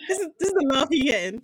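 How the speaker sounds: fast, laughing through it. Real, not acted. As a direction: giggle out the joke. Laughing mockery.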